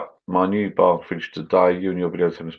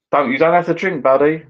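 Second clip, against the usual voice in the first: higher and louder, quick to reassure; eager reassurance; 10 semitones higher, 4 dB louder than usual. Real, not acted. Reassuring a viewer who has work tomorrow, eager and pitched up. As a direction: rush in to tell him he needn't drink.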